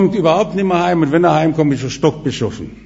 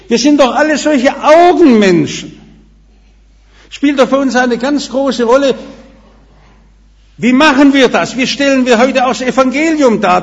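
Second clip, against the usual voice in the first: raised, louder preaching voice; emphatic sermon delivery; preaching at full force; 5 semitones higher, 5 dB louder than usual. Acted. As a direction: rouse the congregation from the pulpit. Preacher appeals to congregation.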